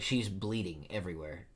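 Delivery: ordinary delivery, nothing marked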